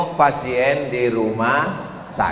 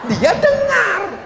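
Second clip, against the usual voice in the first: high, slow, laughing shout of "Dia dengar"; spring the twist with a laughing cry; dramatic punchline; 4 dB louder, slower than usual. Acted. Preacher delivers the twist to the congregation, high laughing cry.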